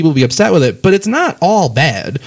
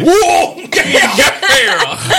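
high mock rocker; much slower than usual. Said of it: "coming to the mic".

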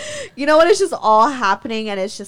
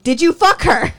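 loud, high, insistent question; raised-voice retold interrogation; heated demand; 8 semitones higher, 7 dB louder than usual. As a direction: demand it louder after getting no answer. Retold question escalates after silence.

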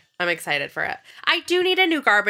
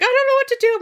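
panicked; 6 dB louder, faster than usual. Retold or imitated.

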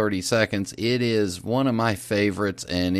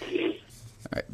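ordinary delivery

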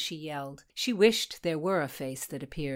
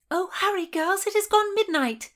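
high, louder hurrying call; urging; 13 semitones higher, 6 dB louder than usual. A narrator's reading. Bridget urges the girls to hurry, high and loud.

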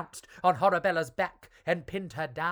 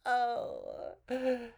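evil cackle; 7 semitones higher, 5 dB quieter than usual. A narrator's reading. Witch laughs before demand.

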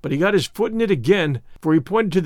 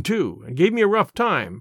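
usual speaking voice

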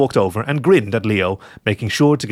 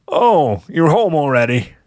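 higher, feigned casual greeting; playing it off; 6 semitones higher, 4 dB louder, slower than usual. A narrator's reading. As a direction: act caught out but casual. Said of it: "trying to play it off".

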